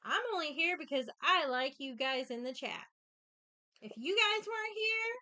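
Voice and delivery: loud, high yelling at chat. Real, not acted.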